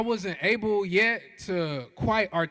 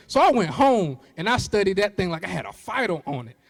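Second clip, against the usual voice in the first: ordinary delivery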